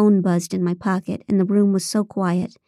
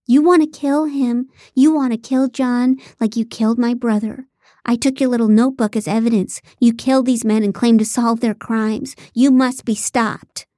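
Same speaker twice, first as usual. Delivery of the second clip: angry accusation; 3 semitones higher, 4 dB louder than usual. Acted. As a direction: accuse a killer with vengeful force. Knife-wielding woman accusing the narrator.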